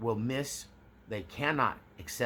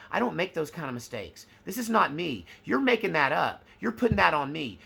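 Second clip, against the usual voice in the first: higher and louder, defensive and rising; escalating mock denial; 6 semitones higher, 5 dB louder than usual. Covert narcissist denying a mistake, shrill rising defence. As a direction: climb in pitch with every denial. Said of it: "with each denial, the pitch raises".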